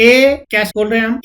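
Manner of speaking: usual speaking voice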